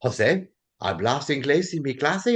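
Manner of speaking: lightly voiced questioning teacher, in Spanish; rising interrogation from a Spanish teacher; imitated teacher catching a student out; Peruvian Spanish teacher. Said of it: "she'd be like".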